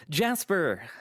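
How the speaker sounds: high, falling greeting call; announcing presence. A narrator's reading. Visitor greets Jasper at the bar, announcing himself. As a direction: call the name to announce yourself. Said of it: "I announced my presence".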